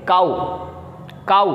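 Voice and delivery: काऊ said with a hard k; model pronunciation. Shown as a demonstration. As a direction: say काऊ starting with a clear k sound. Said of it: "काफ पढ़ेंगे, बोलेंगे". (काऊ को काफ की आवाज़ से बोलिए)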